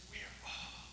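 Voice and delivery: barely voiced, very soft; hushed. Preacher repeating Paul's words to the guard, hushed. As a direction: breathe it out almost silently.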